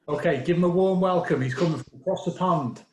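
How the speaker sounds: loud, high club MC hype; announcer intro. Real, not acted. Emcee hyping the crowd for the visiting comic, announcer voice. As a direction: bring the next comic on with a big call.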